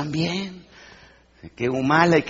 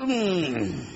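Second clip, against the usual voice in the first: ordinary delivery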